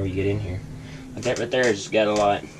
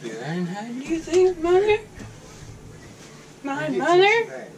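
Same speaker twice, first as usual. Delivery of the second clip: mocking echo; slower than usual. Echoes Dad's "son? My son?" line.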